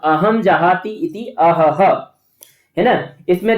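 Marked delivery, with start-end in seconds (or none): 0.0-2.1 अहम जहाती इति अहह drawn out syllable by syllable; slow clear reading. Shown as a demonstration. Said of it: "अब जब कोई पीड़ा होगी".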